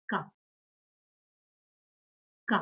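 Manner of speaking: K said as "kah"; German letter K. Shown as a demonstration. Teacher showing learners the German alphabet, K as "kah". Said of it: "Letter K is pronounced as".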